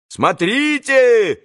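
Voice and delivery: high, long drawn-out call; warning shout. A narrator's reading. Boys shout after Alyosha, warning shout. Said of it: "кричали ему вслед предупредительным".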